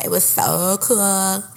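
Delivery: put-on slow, drawn-out valley accent; Santa Barbara accent. Mocking her Santa Barbara classmates in a slow valley accent. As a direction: stretch it like a laid-back California surfer. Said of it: "I'm doing that accent".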